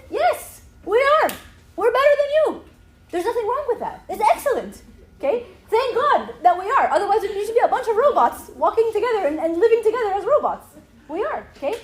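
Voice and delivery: loud, high, emphatic declaration; excited. Real, not acted. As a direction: proclaim it proudly at full voice. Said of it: "like, excited. I'm sure you can tell".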